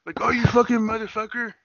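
high, hammed-up yell of a raging streamer; over-the-top gamer meltdown; mock streamer rage. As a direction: fake a streamer losing it at the game. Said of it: "start streaming videos now and be like this".

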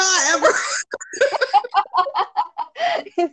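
words breaking into high laughter; cracking up. Real, not acted. Host tickled by co-host's greeting, cracking up. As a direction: lose the sentence to real laughter.

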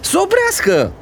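very high, screamed demand; boy screaming for the train to stop. Acted. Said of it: "zbiară și mai tare Goe".

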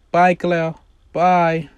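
loud, high sing-song goodbye; mother calling goodbye loudly as the bus arrives; cheery mom. Acted. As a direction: wave her daughter off to the bus. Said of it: "Tiffany says".

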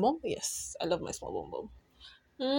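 slow hushed whisper; soft whisper. Real, not acted. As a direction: whisper it fondly. Tender closing affirmation.

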